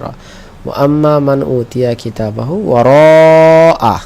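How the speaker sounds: ধীর তিলাওয়াত; slow recitation. Shown as a demonstration. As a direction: recite the verse slowly and clearly. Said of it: "এই যে একটা র।".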